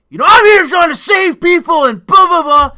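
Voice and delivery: high, loud furious yell; snapping shout. Retold or imitated. EMT snapping at the guard, a high furious shout. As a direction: bark it back like he's lost it. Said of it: "he fucking snapped".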